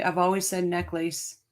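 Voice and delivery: ordinary delivery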